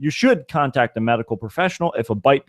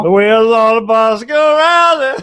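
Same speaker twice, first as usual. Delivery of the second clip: bus driver voice; 7 semitones higher, 3 dB louder than usual. Pretend.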